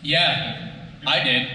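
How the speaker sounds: ordinary talk, nothing marked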